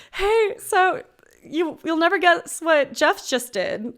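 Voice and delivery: awkward news-breaking. Acted. Phones boyfriend with awkward news.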